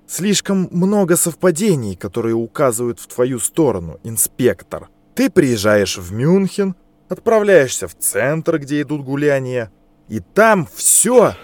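rising angry accusation; accusing. Acted. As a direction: build to a furious outburst. Angry interrogator accuses inspector.

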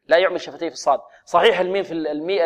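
ordinary delivery

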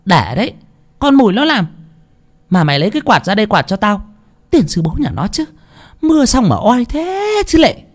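peevish, high-pitched grumbling; bossy mistress. A narrator's reading. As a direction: order the maid about with irritated curses. Cruel mistress ordering her maid.

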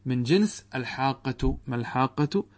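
ordinary talk

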